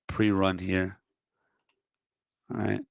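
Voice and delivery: ordinary talk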